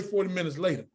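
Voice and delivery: usual speaking voice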